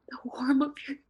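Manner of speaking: crying whisper; whispered sob. Acted. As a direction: let the whisper crack into a cry. Ghost girl breaking down.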